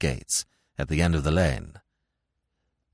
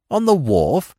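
sharp question; 7 semitones higher, 6 dB louder than usual. A narrator's reading. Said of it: "snapped Smith".

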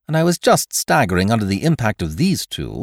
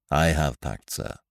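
lower, measured valet voice; calm, deferential butler reply; Jeeves; 7 semitones lower, 5 dB quieter than usual. A narrator's reading. Valet calmly advising his master to leave London. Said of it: "Jeeves replies calmly".

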